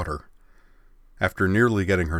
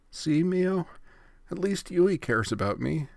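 whimper; 10 semitones higher than usual. Acted. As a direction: whimper for sympathy, hammed up. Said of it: "Ritsu whimpered".

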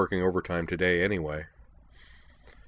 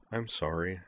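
murmured; 7 dB quieter than usual. A narrator's reading. Utena apologizes for hurting Robo's feelings, murmured. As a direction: murmur it gently. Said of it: "Utena murmured".

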